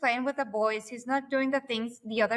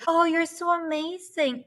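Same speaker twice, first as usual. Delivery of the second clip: drawn-out, higher-pitched mimicked compliment; mock gushing praise; 6 semitones higher than usual. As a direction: gush slowly, imitating an admirer. Mimicking people's patronising praise.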